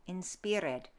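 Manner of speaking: "inspired" as in-SPEER-ed, E D sounded; Middle English pronunciation. Shown as a demonstration. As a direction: give "inspired" an EE and an extra -ed syllable. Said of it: "Middle English".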